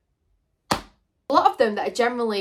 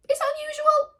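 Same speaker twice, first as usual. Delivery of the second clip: squeaky aside. Pretend.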